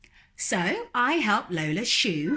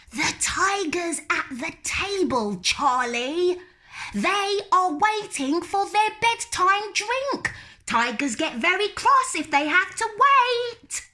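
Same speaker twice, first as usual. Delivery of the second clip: put-on high, stubborn little-girl voice, louder; little girl refusing; 13 semitones higher, 4 dB louder than usual. Lola refusing to go to bed, high and stubborn. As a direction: dig in like a stubborn small child.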